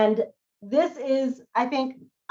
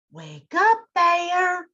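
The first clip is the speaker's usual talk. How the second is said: higher call from the fox; 8 semitones higher, much slower than usual. Acted. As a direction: rouse the lazy bear. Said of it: "cried Fox".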